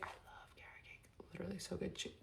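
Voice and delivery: breathy whispered aside; whispering. Real, not acted. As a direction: whisper it like a secret. Whispered aside about carrot cake.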